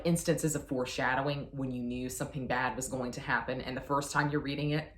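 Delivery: ordinary delivery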